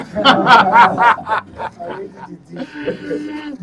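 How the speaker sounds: a big, high burst of laughter; loud laugh. Real, not acted. Host laughing at the guest's politician talk, big laugh.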